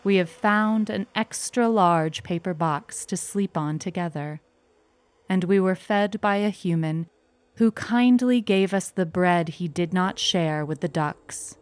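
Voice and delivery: ordinary delivery, nothing marked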